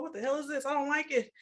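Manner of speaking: normal talking voice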